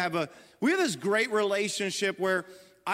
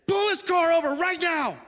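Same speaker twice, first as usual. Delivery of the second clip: a furious shout, much higher and louder; angry shout; 11 semitones higher, 4 dB louder than usual. Retold or imitated. Husband in the car orders his wife to pull over, furious shout. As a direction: yell it at the wife who is driving. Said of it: "So I just said".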